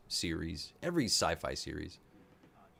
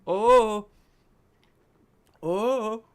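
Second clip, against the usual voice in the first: loud, high-pitched "oh!"; high, loud fan reaction; excited outburst; 14 semitones higher, 11 dB louder than usual. Real, not acted. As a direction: whoop it in thrilled shock.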